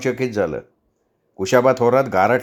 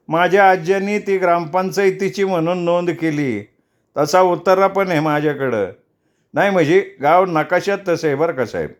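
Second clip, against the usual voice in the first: higher, eager village voice; villager Mukinda's voice; 6 semitones higher than usual. Acted. Villager Mukinda eagerly tells the inspector, in a higher voice. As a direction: pipe up eagerly like a humble villager.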